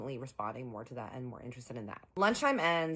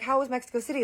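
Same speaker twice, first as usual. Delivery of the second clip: eager question; 11 semitones higher, 8 dB louder, faster than usual. Retold or imitated. Friends catching up.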